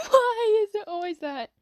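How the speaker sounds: high, slow laugh-sobbing; high slow laughing wail; crying laughter. Real, not acted. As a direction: cry laughing helplessly.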